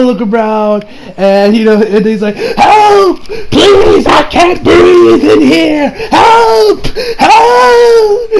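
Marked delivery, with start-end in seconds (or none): 2.4-8.3 hammed-up high, loud cries for help; desperate plea. Retelling the trapped villain begging to get out, desperate wailing. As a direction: wail for help in mock suffocation, pitch soaring.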